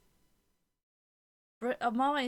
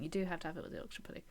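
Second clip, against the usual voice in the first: fast insistence; 3 semitones lower, 5 dB quieter, much faster than usual. Real, not acted.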